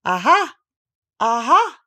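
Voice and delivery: high, loud trial cries; practising an exclamation. A narrator's reading. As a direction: try it out twice, punched up.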